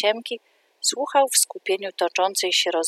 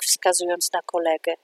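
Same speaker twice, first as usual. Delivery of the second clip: ordinary delivery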